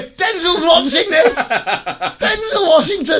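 disbelieving laughing cry. Retold or imitated. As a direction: squeal his name in amused disbelief. Retelling his own disbelief.

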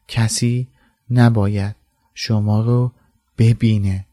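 کسی نباید شما رو ببینه broken into separate words with pauses. A narrator's reading. Dumbledore repeating his warning to the children, word by word.

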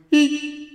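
a few loud, high mock ha's; imitated sparse laugh. Son imitating his mum's laugh, high spaced ha's. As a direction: separate high squeaks with gaps. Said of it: "Sometimes you get a few ha's from her".

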